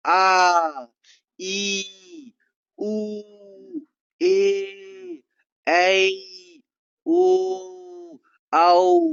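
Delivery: each vowel आ, ई, उ, ए, ऐ, ओ, औ held long, pitched high and loud; drawn-out vowels. Shown as a demonstration. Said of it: "ये थोड़ा खींच के बोले". (दीर्घ स्वर खींचकर)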